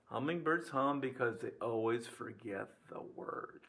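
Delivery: low, soft repeat of the punchline; quiet recap. Real, not acted. Teacher giving students a joke to keep, quietly. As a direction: restate the joke softly and evenly.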